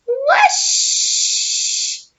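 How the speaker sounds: whispered rising whoosh sound; breathy swooshing noise. Acted. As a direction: blow a high airy whoosh. Map whooshes in story.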